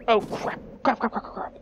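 panicked muttering. Acted. Panics at being the Windfish.

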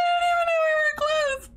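high and loud, half shouting; excited squeal. Real, not acted. Streamer to chat about the milestone, excited high squeal. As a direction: squeal it, caught off guard. Shocked at surprise follower milestone.

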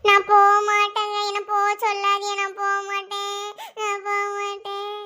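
sing-song wail of refusal; mock sobbing plea; hammed-up crying. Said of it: "அழாத, அழாத".

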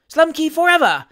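high, loud answering shout; echoed cheer. A narrator's reading. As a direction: echo the mob's cry at full voice. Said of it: "echoed Mister Pickwick".

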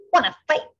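high, loud challenge; grouchy ladybug. A narrator's reading. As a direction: spoil for a fight. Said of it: "said the grouchy ladybug".

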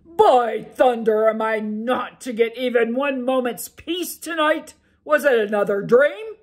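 furious loud ogre demanding answers. Acted. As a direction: roar at your wife for waking you. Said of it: "he demanded".

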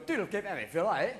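unintelligible mock-Scottish mumble; thick Scottish gibberish; Scottish accent. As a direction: imitate an incomprehensible Scotsman. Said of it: "You land in Scotland and they're going".

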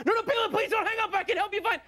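desperate plea. Retold or imitated. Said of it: "I'm like".

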